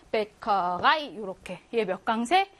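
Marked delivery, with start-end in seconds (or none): 0.1-1.2 메커라이 slowly, landing on 라이; stress on final syllable. Shown as a demonstration. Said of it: "강세가 뒤에 옵니다". (메커라이를 천천히, 끝 '라이'에 강세)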